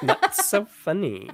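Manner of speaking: high, loud mock Valley Girl; Valley Girl accent. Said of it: "very offensive Valley Girl faux accent".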